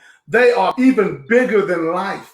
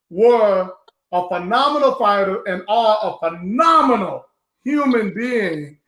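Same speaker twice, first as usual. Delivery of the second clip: ordinary talk, nothing marked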